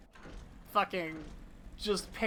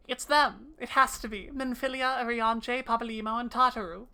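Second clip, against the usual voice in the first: louder, higher urgent reading; 5 semitones higher, 5 dB louder than usual. Acted. Streamer reading Y'shtola's discovery, louder and higher. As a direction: read the names like a breakthrough.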